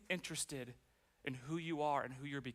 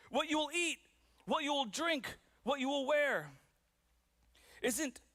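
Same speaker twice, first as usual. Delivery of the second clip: raised, projected preacher's Bible reading, phrase by phrase; scripture reading; 8 semitones higher, 3 dB louder than usual. Acted. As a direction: declaim the verse with long deliberate pauses.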